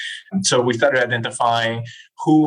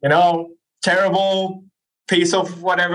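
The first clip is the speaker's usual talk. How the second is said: mock cursing grumble; 6 semitones higher than usual. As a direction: imitate coworkers griping at crappy software. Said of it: "I could hear people cursing everywhere".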